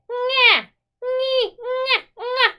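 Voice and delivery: high, loud nasal nya; nasally sound. Shown as a demonstration. Teacher demonstrates the mid-tone sound, nasally. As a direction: push it through the nose, high and loud. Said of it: "this is gonna make a nasally sound".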